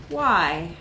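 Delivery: ordinary delivery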